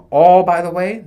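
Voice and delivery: insistent loud repeat. Real, not acted. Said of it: "repeated louder".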